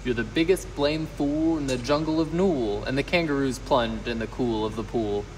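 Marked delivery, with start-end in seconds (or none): 0.0-2.8 higher, put-on kangaroo jeer; jeering taunt. A narrator's reading. Kangaroos ridiculing Horton at the pool, jeering. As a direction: taunt him in a character voice.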